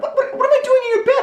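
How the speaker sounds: very high, fast sputtering; squeaky hammed-up outrage; indignant disbelief. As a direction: stammer it back in falsetto. Indignant reply to her accusation.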